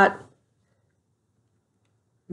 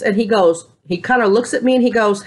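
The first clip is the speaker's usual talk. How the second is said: ordinary talk, nothing marked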